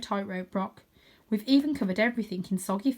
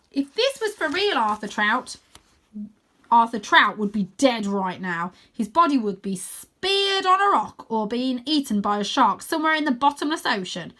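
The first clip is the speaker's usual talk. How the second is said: higher, loud grim lecture; dramatic warning; 3 semitones higher, 6 dB louder than usual. Acted. Stella warning Arthur he'd be dead, dramatic. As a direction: scare them with the danger. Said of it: "She pauses for a dramatic effect".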